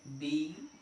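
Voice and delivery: usual speaking voice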